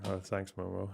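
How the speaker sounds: ordinary delivery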